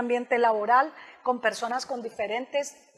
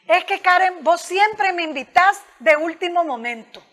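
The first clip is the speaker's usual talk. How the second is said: blaming complaint; 5 semitones higher, 6 dB louder than usual. Shown as a demonstration. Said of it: "desde el tú es hacerte sentir culpable".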